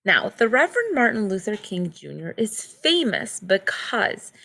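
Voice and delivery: clear, measured read-aloud storybook voice; reading to children. Acted. Teacher reads picture book aloud.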